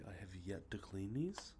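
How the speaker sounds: ordinary talk, nothing marked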